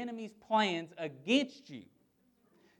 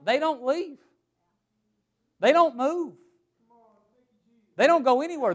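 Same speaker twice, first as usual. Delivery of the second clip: don't raised high each time; insistent don't; 6 semitones higher, 4 dB louder than usual. Real, not acted. Preacher insisting angels stay, high and insistent. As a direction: hammer every don't. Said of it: "preacher building emphasis".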